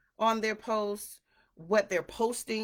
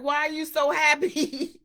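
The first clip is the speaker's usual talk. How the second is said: mock questioning; 7 semitones higher, 7 dB louder than usual.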